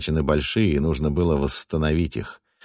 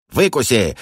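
high, gleeful taunt; joyful exclamation; 6 semitones higher, 3 dB louder than usual. Acted. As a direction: gloat with joy. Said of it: "радостно воскликнул Вус".

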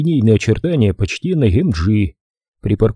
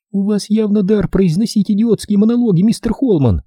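teeth chattering; 11 semitones higher than usual. A narrator's reading. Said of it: "громко стуча зубами".

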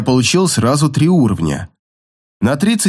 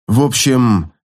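usual speaking voice

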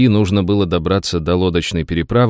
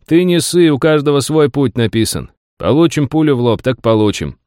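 cocky soldier; 7 semitones higher than usual. Acted. Soldier reassures comrade.

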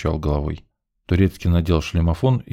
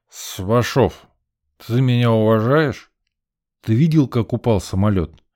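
put-on drunk man; 5 semitones higher, 4 dB louder than usual. A narrator's reading. Drunk Misha voiced.

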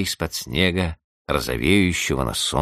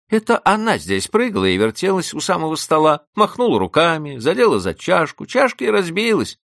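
put-on high, louder girlish voice; eager schoolgirl telling tales; tattling girl; 13 semitones higher, 4 dB louder than usual. Zhenya telling the housekeeper who broke the cup, high girlish.

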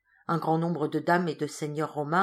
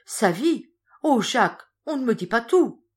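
frightened outcry; 6 semitones higher, 8 dB louder than usual. A narrator's reading. Wife fears husband's life.

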